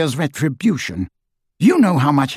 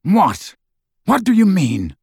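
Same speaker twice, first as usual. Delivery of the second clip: bewildered; 7 semitones higher, 3 dB louder than usual. A narrator's reading. Snape dazed, demands meaning.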